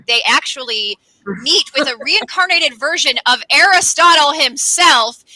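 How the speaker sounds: ordinary talk, nothing marked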